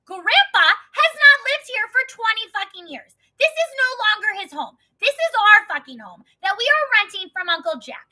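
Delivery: high, loud indignant tirade; retold angry rant, hammering each phrase; snapped. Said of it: "I just, like, snapped".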